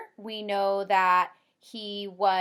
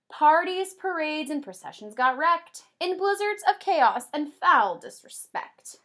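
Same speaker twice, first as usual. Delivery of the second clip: lively storybook read-aloud, higher and louder; reading to children; 5 semitones higher, 5 dB louder, slower than usual. Acted. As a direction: read the rhyme like a bedtime book for kids.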